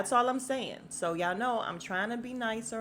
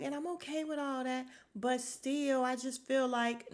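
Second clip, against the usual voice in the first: mock whiny excuse; 5 semitones higher than usual. Mocking contestant's excuse.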